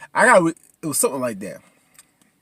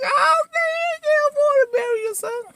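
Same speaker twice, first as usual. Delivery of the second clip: hammed-up, very high crying; mock wailing; 5 dB louder than usual. Viewer weeping over the mama's burial line, hammed-up wail. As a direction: bawl it out in a squeaky falsetto.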